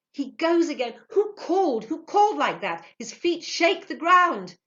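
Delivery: high, very loud alarm; frantic, acted shouting; panicked cries. A narrator's reading. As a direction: cry out in terror as the crowd flees. Panicked worshippers cry out.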